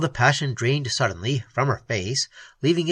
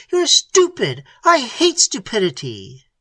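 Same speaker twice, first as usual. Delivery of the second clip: furious; 10 semitones higher, 6 dB louder than usual. Acted. Angry dismissal continues.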